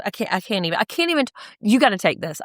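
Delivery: ordinary talk, nothing marked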